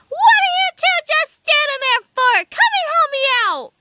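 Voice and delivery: high, strained cry for help; indignant little man's high-pitched demand; struggling little man. A narrator's reading. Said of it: "the little man was struggling".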